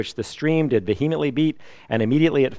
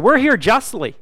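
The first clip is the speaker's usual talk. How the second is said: high-pitched, louder challenge; indignant rebuke; 12 semitones higher, 6 dB louder than usual. Retold or imitated. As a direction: rebuke him in a much higher, raised voice. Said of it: "he rebuked the other one".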